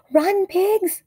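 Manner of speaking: high urgent yell; cried out. A narrator's reading. Explorer fleeing monster, urgent high cry to pigs. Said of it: "cried Fluffy the Explorer".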